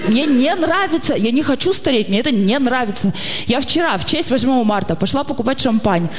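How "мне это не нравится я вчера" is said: ordinary talk, nothing marked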